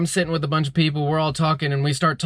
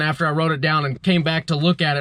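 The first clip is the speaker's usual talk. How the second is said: ordinary talk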